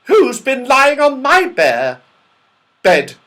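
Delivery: high, loud, indignant bear voice; Mother Bear. A narrator's reading. Mother Bear finds someone on her bed, indignant. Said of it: "said Mother Bear".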